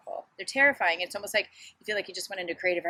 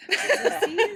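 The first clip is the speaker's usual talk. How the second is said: high, loud laughing question; 8 dB louder than usual. Real, not acted. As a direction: laugh at the word. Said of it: "We're writers".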